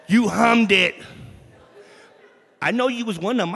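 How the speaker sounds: loud, teasing call to the crowd; playful loud call-out. Real, not acted. Preacher teasing the man who hummed, playfully loud. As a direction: catch him out with a laugh.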